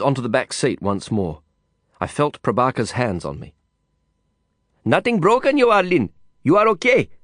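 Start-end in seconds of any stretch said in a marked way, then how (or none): 4.8-7.1 put-on high Indian-accented voice, urgently checking on a friend; anxious Indian friend. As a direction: play the worried companion, pitched well up. Worried friend checks after crash.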